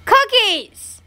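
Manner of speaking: scolding call; calling out sharply. Real, not acted. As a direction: holler the name in exasperation. Yelling for the pet.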